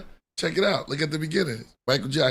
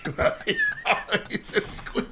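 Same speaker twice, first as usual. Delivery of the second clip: high, slow, breathy cackling Joker; 4 dB quieter, slower than usual. Retold or imitated. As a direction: do the Joker with a laugh. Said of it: "I said it like the Joker".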